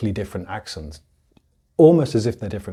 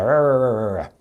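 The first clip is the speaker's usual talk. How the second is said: imitated American R. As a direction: run of rolling-on American "errr" noises. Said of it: "American accent that it sounds like".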